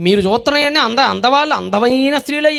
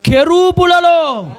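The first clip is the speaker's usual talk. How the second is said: awed exclamation; 5 semitones higher, 4 dB louder, much slower than usual. Acted. Awed emphasis.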